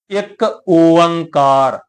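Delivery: ਇੱਕ ਓੰਕਾਰ drawn out very slowly, ਓੰਕਾਰ stretched with a falling end; slow careful recitation. Shown as a demonstration. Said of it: "ਤੇ ਅਸੀਂ ਉਚਾਰਨ ਕੀ ਕਰਦੇ ਹਾਂ?". (ਇੱਕ ਓੰਕਾਰ ਬਹੁਤ ਹੌਲੀ, ਖਿੱਚ ਕੇ ਉਚਾਰੋ)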